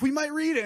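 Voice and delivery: might leaned on, high and loud; stress on might. Retold or imitated. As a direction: punch the word might as a teasing maybe. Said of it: "Emphasis on the word might".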